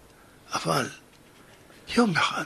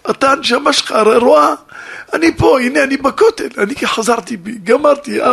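higher, louder crying plea to a father; tearful plea; 7 semitones higher, 7 dB louder than usual. Acted. Daughter at the Western Wall begs her dead father, crying plea. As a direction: beg for forgiveness through tears. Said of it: "בוכה וכותבת".

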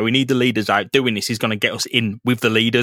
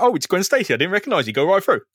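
policeman's sudden welcome; 7 semitones higher, faster than usual. Retold or imitated. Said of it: "he's like".

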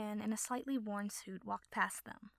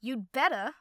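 loud, angry snap back; furious reply; 2 semitones higher, 10 dB louder, slower than usual. A narrator's reading. Partner snapping back at the other man, furious loud retort. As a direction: bite back in fury. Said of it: "the other replied furiously".

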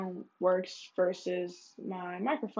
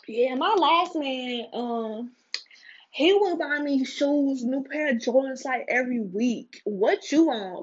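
put-on loud high bragging girl voice; mock the bragging girlfriend; 4 semitones higher, 6 dB louder than usual. Imitating bragging girls.

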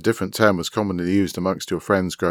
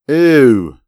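loud, high, drawn-out falling ew; disgusted; 8 semitones higher, 13 dB louder than usual. A narrator's reading. Boy realises what he was reading about, disgusted.

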